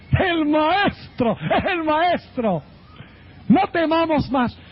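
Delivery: high, drawn-out cry of recognition; acted excitement. Peter telling the disciples it is the Master, excited.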